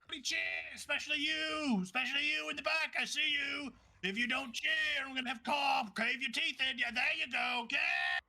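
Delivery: high, strained hype-man holler; ring announcer. Acted. Announcer threatens the crowd into cheering, high pushy holler.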